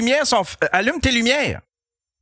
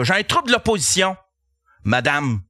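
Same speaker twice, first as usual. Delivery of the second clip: ordinary delivery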